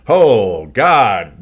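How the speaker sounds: exasperated. Real, not acted. Dismay at defense.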